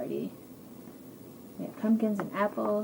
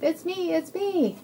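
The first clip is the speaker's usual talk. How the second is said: high-pitched happy reveal; excited sheep; 8 semitones higher, 4 dB louder, slower than usual. Acted. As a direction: pipe up high as the unmasked sheep.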